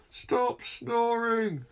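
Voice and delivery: high, very slow drawn-out call; neighbour shouting. Acted. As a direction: stretch it out across the street. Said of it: "shouted the voice from across the street".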